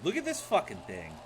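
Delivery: normal talking voice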